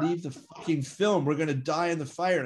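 retold panic, voice jumping up high; panicked shout. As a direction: friend yelling to abandon the film as fire spreads, panicked shout. Gene panicking in hotel fire.

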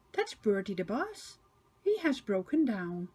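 ordinary delivery

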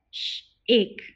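Sh-ache split into onset and long vowel; segmented. Shown as a demonstration. Teacher sounding out Sh-ache for the class. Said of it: "Repeat with me".

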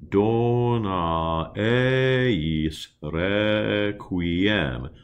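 "Dona eis requiem" with each E open, as in "bed"; slow and exaggerated, open every E; teacher modelling open Latin E; open E vowels. Shown as a demonstration. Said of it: "Those three E vowels are all what we call open".

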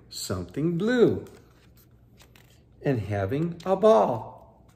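put-on lively read-aloud for children; story narration. A narrator's reading. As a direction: tell it like a bedtime picture book. Reading picture book aloud.

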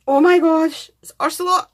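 loud, high delighted cry of discovery; thrilled cry. Real, not acted. Unboxing a friend's gift, she cries out in delight. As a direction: shout with joy at the find. Finds Ursula die cut.

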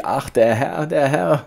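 loud, high wail climbing on the repeat; screaming maid. A narrator's reading. Maid finding the master amid choking smoke, screaming. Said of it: "das Dienstmädchen schrie".

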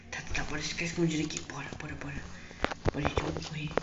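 fast, urgent whisper; whispering to friends while hiding; hurried whisper. Acted. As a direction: hiss it so the witch can't hear. Hiding from the witch.

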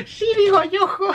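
put-on very high girly voice, amused; imitación burlona. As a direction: squeal it mockingly through amusement. Repeats the subtitle joke, laughing. (falsete burlón entre risas)

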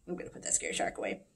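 hushed, quick whisper; whispered aside. Real, not acted. Teacher whispers an aside to the kids, hushed. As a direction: drop to a fast whisper.

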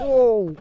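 sudden sharp cry of pain; pained yelp. Real, not acted. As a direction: yelp as the leg sinks. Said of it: "ow, my freaking leg".

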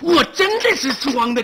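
loud, slow protest; emphatic insistence. Acted. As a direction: stretch it out forcefully to be believed. Insisting madness was faked.

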